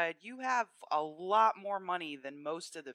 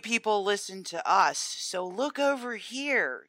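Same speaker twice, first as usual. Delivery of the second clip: loud, higher, condescending impersonation; mock superior tone; 6 semitones higher, 6 dB louder than usual. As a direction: talk down to the masses, slowing at the end. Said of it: "superior tone of the whole thing".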